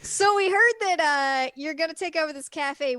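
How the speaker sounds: a hint of government men; mock official warning. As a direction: announce it like suited officials. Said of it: "they're like".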